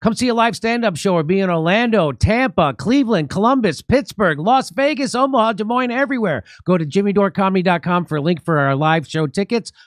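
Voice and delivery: high, singsong announcer plug; promo read. Acted. Host plugging live stand-up show tickets to viewers, promo read. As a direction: sell the tour with a punchy, lilting pitch.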